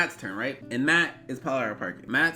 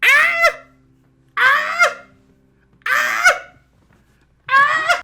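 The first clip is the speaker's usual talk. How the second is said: repeated screams; 13 dB louder, much slower than usual. Retold or imitated. Screaming at bloody stranger.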